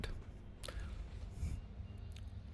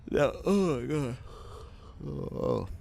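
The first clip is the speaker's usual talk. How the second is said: yawning; 8 semitones higher than usual. Real, not acted. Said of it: "I'm sorry I'm yawning".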